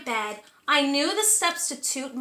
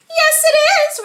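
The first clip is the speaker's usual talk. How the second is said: much higher and louder, silky-voiced monster; a dainty monster greeting, pitched way up; 12 semitones higher, 9 dB louder than usual. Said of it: "high, silky voice".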